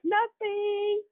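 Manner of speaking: put-on high, innocent child's reply; sweetly innocent kid voice; innocent nothing. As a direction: play the little girl with nothing to hide. Kids answer innocently.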